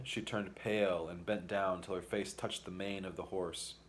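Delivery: ordinary delivery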